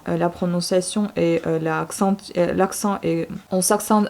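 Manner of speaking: ordinary talk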